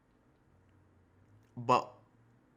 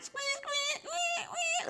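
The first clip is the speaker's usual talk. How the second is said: much higher, crying squeak, squeak; piglet squeaks; 3 dB quieter, much slower than usual. Retold or imitated. Reactor imitates pig sounds, very high squeals. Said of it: "That's how they sound".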